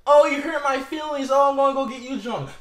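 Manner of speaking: high, loud sneering mimicry of a crybaby; mocking whiny voice. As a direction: mimic him like a whining baby. Mocking the rapper's whining.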